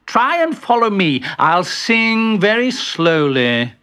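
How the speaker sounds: slow down as the instruction ends; bossy instruction. Acted. Bertie leading the carol, slow bossy instruction.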